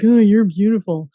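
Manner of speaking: lightly hammed-up admirer; mocking a sexist commenter. Mimicking a leering commenter, mock fawning.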